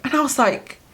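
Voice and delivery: normal talking voice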